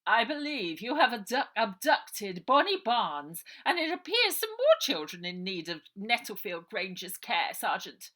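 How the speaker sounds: loud, haughty, stern matron; commanding Missus Buller. Acted. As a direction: lay down the law, pointing.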